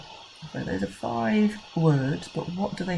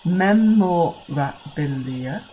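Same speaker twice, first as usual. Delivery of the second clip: "Memorabilia" said slowly, each syllable separate; a teacher counting syllables for children, slowly; 5 dB louder, much slower than usual. Shown as a demonstration. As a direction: clap through "Memorabilia" beat by beat. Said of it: "all have six syllables".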